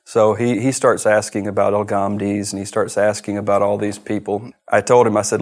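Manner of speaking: ordinary talk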